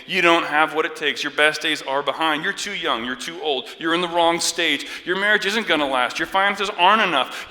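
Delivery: loud, high, relentless accusing tirade; devil shouting lies. Acted. Devil tearing down a believer, shouted accusations. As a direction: hammer each accusation home at full volume. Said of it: "The devil shouts".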